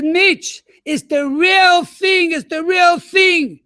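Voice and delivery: high, loud and slow urgent news; urgent announcement. Retold or imitated. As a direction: spell it out slowly, bursting with urgency. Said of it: "I said".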